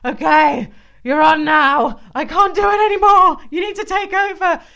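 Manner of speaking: loud, high, desperate plea; exhausted mum. Retold or imitated. Mum pleading with her husband at dawn, loud high desperation. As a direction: beg to hand over the shift. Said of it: "go up to my husband and be like".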